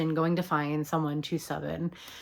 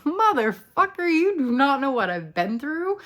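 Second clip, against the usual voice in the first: high, loud sassy retort; 8 semitones higher, 5 dB louder than usual. Acted. Erica putting Eddie in his place, sassy. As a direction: fire back with outsized sass, pitched way up. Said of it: "Erica is this little sassy monster".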